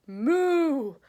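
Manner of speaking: a big, high, loud mooing cow; a hammed-up farmyard moo. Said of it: "Then the cow said".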